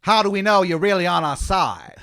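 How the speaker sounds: put-on loud, high, overblown Boston accent; awful Boston accent. Colonist doubting the newcomer's loyalty, loud awful Boston accent. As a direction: belt it out in terrible Bostonese. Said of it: "he says in that awful accent".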